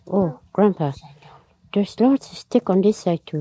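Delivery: mildly protesting call across to an elder; calling to Grandpa. Acted. Girl answers Grandpa about the other side, mildly called out.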